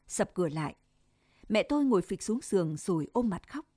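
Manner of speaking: plain reading voice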